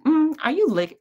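high, sceptical "mm" into the question; eye-rolling disbelief. Retold or imitated. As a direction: question it with a mock-offended eye-roll. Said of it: "roll my eyes and say".

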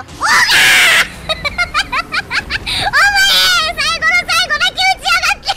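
high, loud mock outrage; laughing shout. Real, not acted. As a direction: yell at the lazy helper. オーガが最後だけ攻撃. (高く大声で笑いながら怒鳴る)

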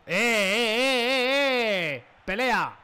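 cántico fuerte y agudo de estadio; cántico de grada. Real, not acted. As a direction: anima como un hincha en la grada. Celebra con sus seguidores. (cántico de hincha, fuerte y agudo)

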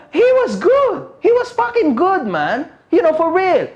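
high, amazed praise; excited praise. Real, not acted. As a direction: rave about it. Hyping rival's performance. (namamanghang papuri)

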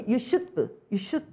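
"should" with a tiny "브" tacked on; reduced "should have". Shown as a demonstration. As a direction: say "you should" as it sounds, "have" shrunk to "브". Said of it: "이렇게 들립니다".

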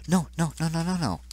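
rapid-fire high-pitched panic; panicked no's. Real, not acted. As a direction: protest in high alarm. Panic as death approaches.

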